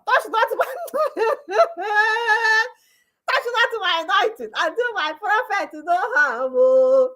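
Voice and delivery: high mock plea collapsing into laughter; mock churchgoer cracking up; laughing mock. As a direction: laugh through the mocking quote. Mock quote breaks into laughter.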